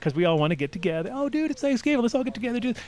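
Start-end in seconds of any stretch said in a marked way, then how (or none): 1.1-2.8 pitched way up, mocking a buddy; high mock excitement. Mocking friends planning Thanksgiving, high and giddy. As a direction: squeal it like an excited bro.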